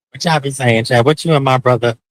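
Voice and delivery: ordinary talk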